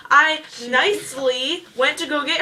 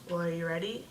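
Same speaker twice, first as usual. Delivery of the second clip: low, quiet clerk; 6 semitones lower, 11 dB quieter than usual. Retold or imitated. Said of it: "He's like".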